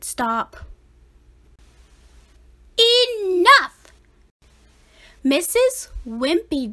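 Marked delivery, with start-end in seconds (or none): 2.8-3.9 loud high command; stern shout. A narrator's reading. Teacher stopping the chase, loud command. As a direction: end it with one shout.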